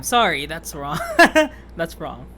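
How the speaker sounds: real laugh bursting between the words; laughing at your own slip; amused self-correction. Real, not acted.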